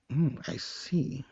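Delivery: very soft, slow and drawn out; acted pensive murmur; quiet musing. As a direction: let it out slowly under the breath. Acted pensive reply of villainess.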